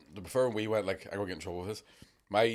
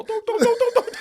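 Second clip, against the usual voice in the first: very high, loud squeaky pleading; pleading prawn; 10 dB louder than usual. Pretend. Guest voices the prawn begging not to be eaten. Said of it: "prawns all".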